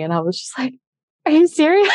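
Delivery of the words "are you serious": high, loud retold disbelief; incredulous. Girlfriend reacting to the marriage proposal, incredulous. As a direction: blurt it out in happy disbelief.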